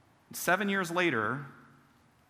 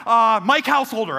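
loud, higher nervous blurt of a fake name; panicked blurt; 6 semitones higher, 9 dB louder than usual. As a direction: blurt out another pastor's name in fear. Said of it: "I'm like".

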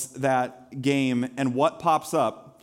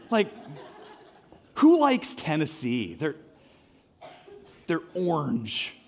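mock disdain. Pastor to church, mock disdain for Tennessee. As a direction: sneer at the rival team half in jest.

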